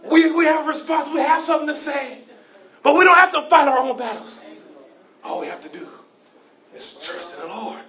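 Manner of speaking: loud building preacher's cadence, shouted peaks; preaching. Acted. Pastor to congregation, preaching with full force. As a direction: preach it hard, building each line.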